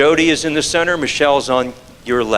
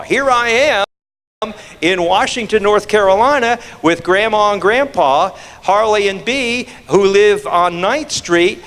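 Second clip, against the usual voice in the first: chirpy grandson; 6 semitones higher than usual. Retold or imitated. Grandson posting about visiting grandparents, chirpy. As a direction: read the post like a proud kid. Grandson's Facebook post voiced.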